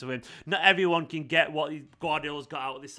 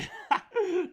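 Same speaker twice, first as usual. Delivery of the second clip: sudden high laugh. Real, not acted. Laughing at a chat comment.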